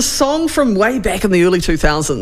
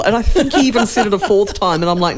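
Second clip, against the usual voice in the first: ordinary talk, nothing marked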